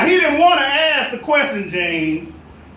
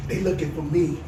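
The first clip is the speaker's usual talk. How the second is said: hushed words with a giggle; whispered laugh; 7 semitones lower, 7 dB quieter than usual. A narrator's reading. Hiding boy laughing softly to the boss on the phone. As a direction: whisper it while laughing at the joke. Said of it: "still whispering, but he started laughing".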